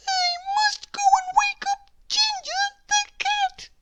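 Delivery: very high squeaky voice; decide it in a tiny mouse voice; determined little mouse. A narrator's reading. Mouse deciding to wake the cat. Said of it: "thought John Mouse".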